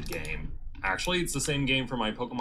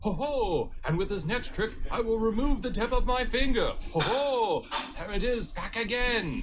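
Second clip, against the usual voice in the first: stage magician; 7 semitones higher than usual. Acted. Magician's finger trick.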